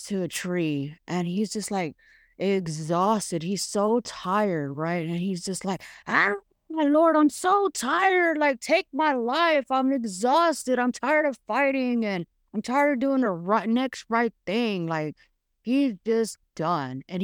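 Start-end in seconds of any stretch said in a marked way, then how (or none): 6.1-14.9 Elijah worn out. Acted. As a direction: play Elijah begging God to end it, drained. Voicing Elijah's weary prayer.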